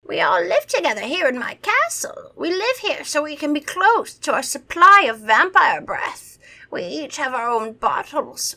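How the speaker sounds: put-on high, theatrical old vampire; hammed-up vampire voice; vampire impression. A narrator's reading. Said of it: "That's my vampire impression".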